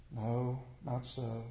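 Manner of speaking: low, hushed snake denial; soft reassurance. Acted. Snake answers the youth's accusation, soft and soothing. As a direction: gently deny it, low and soft.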